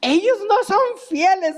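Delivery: mother imitating her young son's complaint, mildly childlike. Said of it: "Y él decía".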